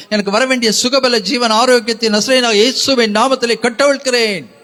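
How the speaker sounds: loud, high prayer building in force; fervent declarative prayer. Real, not acted. Preacher leading the congregation in claiming blessings, fervent loud prayer.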